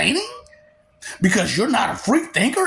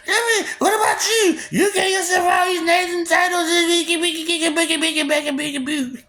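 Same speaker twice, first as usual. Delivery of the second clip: mocking whine; 13 semitones higher than usual. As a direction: whimper out the taunt like a baby. Mocking the listener's grand titles.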